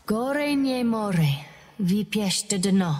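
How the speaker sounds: quoting a proverb from home, slow and solemn; recited proverb. Acted. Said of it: "It's a proverb from back home".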